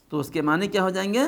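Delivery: ordinary delivery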